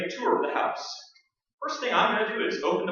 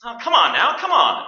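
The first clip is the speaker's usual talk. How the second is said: coaxing the dog; 3 semitones higher, 10 dB louder than usual. Acted.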